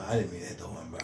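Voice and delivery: breathy whispered apology. Retold or imitated. Co-host voicing the apologetic driver, whispered. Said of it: "repeats whispered".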